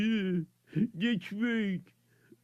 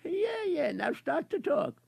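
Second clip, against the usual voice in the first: ordinary talk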